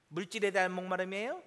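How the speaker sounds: usual speaking voice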